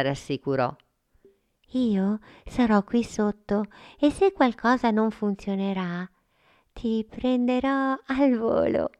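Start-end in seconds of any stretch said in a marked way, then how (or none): 1.7-8.9 put-on higher girl's voice, kind and coaxing; higher girl voice encouraging Frullina; encouraging little girl. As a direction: gently urge a friend to try. Sofia reassures Frullina.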